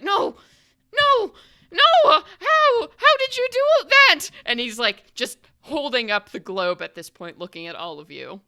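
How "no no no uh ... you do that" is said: high, shrill panicked squeal; panicked gnome. Acted. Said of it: "It's just like".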